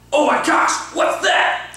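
big gasp, then high, alarmed cry; mock suspense. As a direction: play up the shock like a kid in a skit. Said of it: "wanna create suspense".

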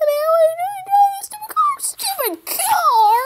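very high, screeched complaint at the car; high-pitched outburst. Acted. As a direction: rant in a squeal, then drag out the last words.

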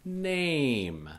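NAME drawn out, high then falling; slowed down. Shown as a demonstration. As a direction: stretch the long A in NAME. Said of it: "I'll do it slowly".

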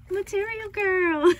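loud, high delighted exclamation; loud gleeful cry then laugh; excited shout. Real, not acted. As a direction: burst out laughing as you read it.

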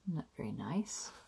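soft whispered comment; whispered aside. Real, not acted. Reader showing the giant picture to listeners, whispered aside. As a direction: breathe it as a hushed aside.

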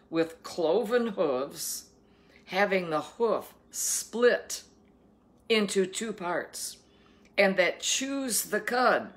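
slow, emphatic proclamation of scripture; scripture reading. Acted. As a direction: read it out deliberately, leaning on key words. Reads scripture aloud.

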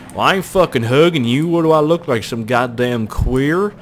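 put-on loud, indignant country accent. Imitating cousin's boyfriend refusing a hug, loud country accent. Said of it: "he's really country".

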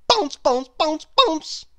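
excited bouncy calls. A narrator's reading. Bouncing down the stairs.

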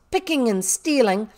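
loud, indignant schoolmistress; acted angry telling-off; angry scolding. A narrator's reading. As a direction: accuse with scornful outrage. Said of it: "said Miss Minchin's voice".

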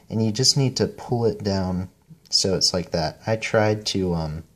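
ordinary talk, nothing marked

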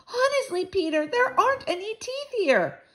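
high-pitched whiny insistence; exasperated girl. A narrator's reading. Girl insisting to boy the teeth are gone, whining. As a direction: plead it in a high girlish voice. Upset girl gives up the search.

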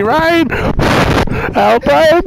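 hammed-up calling for help over the wind; mock call for help.

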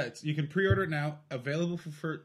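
ordinary talk

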